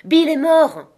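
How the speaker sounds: loud, high cry of alarm; high startled yell from indoors; sudden exclamation. A narrator's reading. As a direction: shout out the startling news. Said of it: "une exclamation jaillit de l'intérieur".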